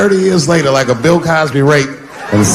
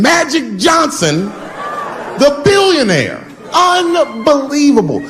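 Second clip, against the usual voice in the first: mock disbelief; 10 semitones higher, slower than usual. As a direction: shout the twist like you can't believe it. Punchline reveal of billionaire.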